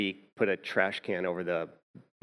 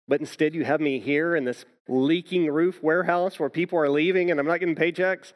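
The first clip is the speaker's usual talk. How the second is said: louder and higher, retelling a bitter complaint; complaining; 7 semitones higher, 5 dB louder than usual. Retold or imitated. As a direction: vent the grievance, heating up. Retold complaint to the Lord.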